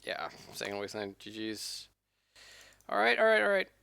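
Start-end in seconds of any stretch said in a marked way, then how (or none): 2.9-3.7 resigned exclamation. Real, not acted. End of league, loud sigh.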